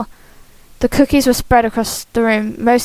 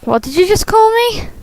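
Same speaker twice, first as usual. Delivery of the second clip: high, offended question; indignant character voice; 10 semitones higher than usual. Acted. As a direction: play it outraged and high. Voicing Shane's indignant reply.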